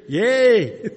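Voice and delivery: high loud mock cheer; excited cheer. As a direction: cheer like a happy crowd. Mimicking culture cheering the verse.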